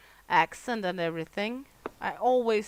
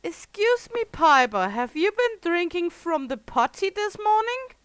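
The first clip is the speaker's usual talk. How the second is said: mock scandalized voice; 9 semitones higher, 7 dB louder than usual. Mock question from a viewer.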